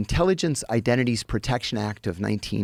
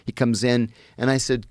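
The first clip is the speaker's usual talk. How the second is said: ordinary talk, nothing marked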